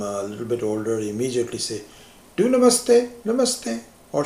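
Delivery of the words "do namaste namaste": put-on parent prompting child. Parent teaching child manners.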